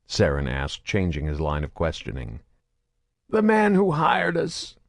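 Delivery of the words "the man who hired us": slow, high raw muttering; raspy mutter. A narrator's reading. Grotto mutters hoarsely after screaming. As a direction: mutter through a torn throat. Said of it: "Grotto muttered, voice raw and raspy".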